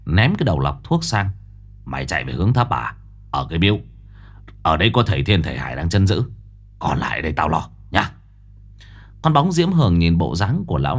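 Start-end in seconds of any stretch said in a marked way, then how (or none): 1.8-8.1 low, fast, soft gangster voice; cold gruff tough guy. Acted. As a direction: brush her off coolly. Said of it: "hừ lạnh".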